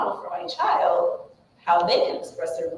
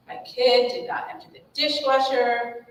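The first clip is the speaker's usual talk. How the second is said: usual speaking voice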